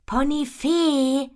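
loud, high, drawn-out "Ponifee"; impatient, stretched-out name; impatient repeat. A narrator's reading. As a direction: repeat "Ponifee" slowly and loudly, losing patience. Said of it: "wiederholt Ponifee ungeduldig".

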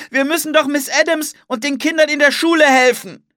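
high, loud plea shouted out; urgent scream. A narrator's reading. Anne pleads to help the schoolchildren, shouting. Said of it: "schrie Anne".

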